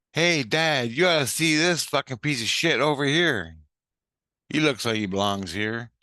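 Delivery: mocking girl. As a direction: imitate a girl calling Dad over to jeer. Voicing yuppie girl mocking him.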